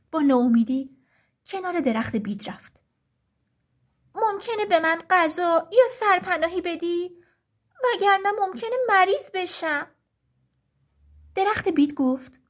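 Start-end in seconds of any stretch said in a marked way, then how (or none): 4.1-9.9 high, small pleading bird voice; a tiny cold bird begging for help; little lark voice. Acted. The little lark begs the birch tree for help, high and pleading.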